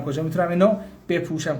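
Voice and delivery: usual speaking voice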